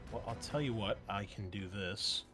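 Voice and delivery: ordinary delivery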